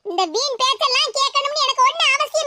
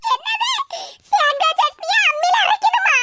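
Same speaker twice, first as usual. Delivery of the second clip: shocked outcry; 5 semitones higher than usual. Acted. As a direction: wail in disbelief at the order. Shocked at grinding-stone order. (அதிர்ச்சியுடன் அலறல்)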